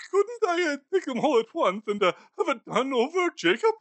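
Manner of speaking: put-on a frightened old man trying to strike a deal; bargaining Scrooge.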